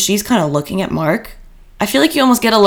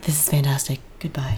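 fading mock whisper; 4 semitones lower, 8 dB quieter than usual. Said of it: "they're like".

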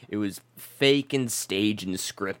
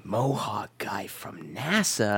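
slow, breathless whispered question; 5 semitones lower, 4 dB quieter, slower than usual. Real, not acted. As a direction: whisper it back slowly. Repeating name in a whisper.

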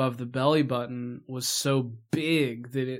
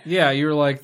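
usual speaking voice

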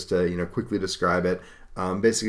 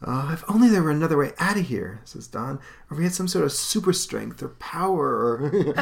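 put-on much higher, wistful young voice; wistful hero; 7 semitones higher than usual. Don wishing for super powers, wistful. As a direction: daydream aloud.

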